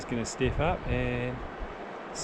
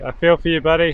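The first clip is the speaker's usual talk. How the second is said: louder, higher, sent across to a buddy; raised voice, commiserating with a mate; calling out; 5 semitones higher, 8 dB louder than usual. Real, not acted. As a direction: call your sympathy over the water. Calls sympathy out to angler.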